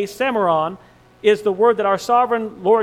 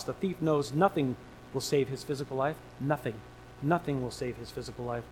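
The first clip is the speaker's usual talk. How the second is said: hushed emphasis; 6 semitones lower, 9 dB quieter than usual. Real, not acted. Hushed repetition of nothing.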